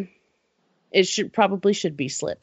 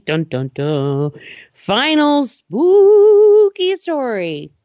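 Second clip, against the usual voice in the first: slow, louder, high dun dun dun; dramatic spooky announcer; 6 semitones higher, 5 dB louder, much slower than usual. Acted. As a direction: drag out the spooky reveal.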